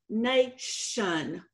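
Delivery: "nation" slowly split as NAY, shun; syllable-by-syllable demonstration. Shown as a demonstration. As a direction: break "nation" in two to show the sh sound. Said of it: "can be spelled with a T I".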